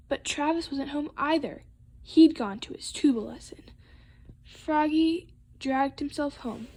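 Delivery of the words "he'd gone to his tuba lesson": ordinary delivery, nothing marked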